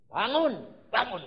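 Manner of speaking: loud, high, punched command; wake-up command. Acted. Preacher urges tired body to get up, commanding. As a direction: call it out sharply to rouse a sleeper.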